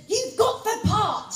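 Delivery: high, slow, emphatic mock crossness; rather crossly. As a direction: imitate an irritated agent announcing news. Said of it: "she said rather crossly".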